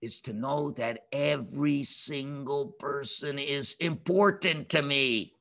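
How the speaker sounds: low, slow, measured pronouncement; God speaking. Acted. God reassuring the slaves each matters, slow and low. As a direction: lay it out slowly and low, with weight. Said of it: "So Hashem says".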